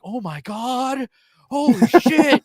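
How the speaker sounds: slow, high horrified reaction; hammed-up appalled disbelief; mock shock. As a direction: play an aghast onlooker. Friends and family react in horror.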